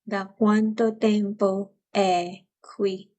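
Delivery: slow, careful Italian; teacher repeating Italian slowly. Shown as a demonstration. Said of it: "you would say".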